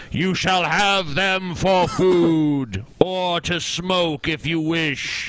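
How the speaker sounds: booming, slow voice of God from the heavens. Shown as a demonstration. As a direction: boom it out like God from the sky. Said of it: "read this in your God voice".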